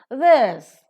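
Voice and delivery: "This" punched loud and high, then falling; stressed THIS. Shown as a demonstration. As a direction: hit "This" hard and draw it out. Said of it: "which is to be stressed".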